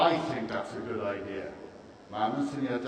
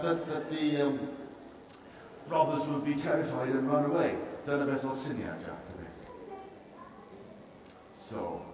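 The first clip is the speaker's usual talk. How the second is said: ordinary delivery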